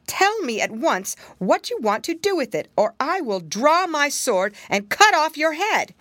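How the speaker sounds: high, loud menacing threat; threatening soldier. Acted. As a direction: threaten her with the sword.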